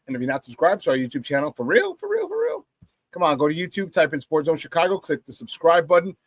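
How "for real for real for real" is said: high-pitched pleading. Acted. Playful pleading to subscribe.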